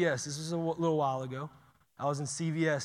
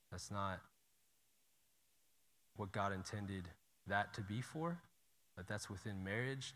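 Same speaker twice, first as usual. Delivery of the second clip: gentle quiet words; 6 semitones lower, 10 dB quieter than usual. Retold or imitated. Quietly praying for the cashier.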